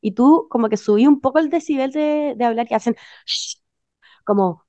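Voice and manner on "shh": shushing. Retold or imitated. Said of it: "como susurrando".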